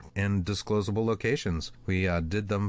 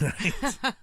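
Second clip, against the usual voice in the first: high laugh. Real, not acted. Laughing at the story's end.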